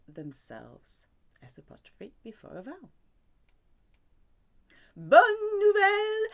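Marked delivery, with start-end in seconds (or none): 5.0-6.3 high, loud, slowed cheer of good news; excited cheer. Real, not acted. Teacher cheering students on with high, loud joy.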